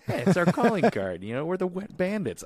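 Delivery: a hint of Marv bragging about the trick; proud Marv. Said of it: "And he goes".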